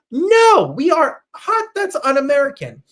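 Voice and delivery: high, loud indignant no; mock outrage. Real, not acted. Host scandalized by a coworker's question, high and loud. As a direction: act scandalized at hot dogs in pasta.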